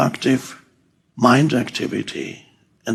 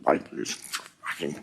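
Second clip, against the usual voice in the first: unvoiced-sounding mumble, barely audible; muttering; 10 semitones lower, 12 dB quieter than usual. Imitating a man muttering to himself in the street, hushed mumbling. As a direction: mutter curses to yourself under your breath. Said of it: "man walking in the street muttering".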